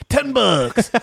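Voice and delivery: very high cartoon pitchman catchphrase; Mister Allen. Quoting a commercial's price gag, high cartoon salesman. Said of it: "pop in the corner and go".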